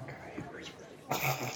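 breathy unvoiced grumble; whispered aside. Real, not acted. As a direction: whisper it under your breath. Player aside about dice roll.

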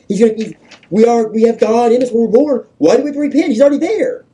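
very high, loud protest; worked-up, incredulous outcry; heated objection. Real, not acted. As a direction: argue it at the top of your range. Voicing a heated objection to repentance.

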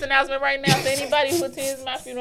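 loud fast mock PSA; announcement voice. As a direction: announce it like a broadcast. Public service announcement bit.

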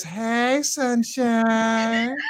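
a hint of a sing-song hello to a friend; Kat's greeting. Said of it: "That's how I hear Kat".

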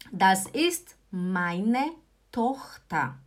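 very slow, word by word; slow model pronunciation. Shown as a demonstration. Teacher modelling a German sentence for learners, slowly. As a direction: say it slowly with spaces between the words. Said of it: "A minha língua fica aqui embaixo quando eu falo O".

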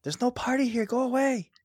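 high mock lady voice shooing him off; lady at the door telling a drunk guest to leave, high mock voice; imitating a grumpy lady. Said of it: "some lady was like".

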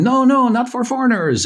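high mock crowd shout, insistent; a retold crowd yelling at a foreigner to back off. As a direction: bark out the refusal like an angry crowd. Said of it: "the crowd starts yelling at me going".

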